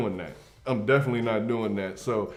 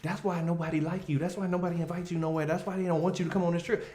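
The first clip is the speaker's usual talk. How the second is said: higher, fast lisping insult; 5 semitones higher, 4 dB quieter, faster than usual. Retold or imitated. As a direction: lisp through a fast jab. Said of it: "with a little lisp on it".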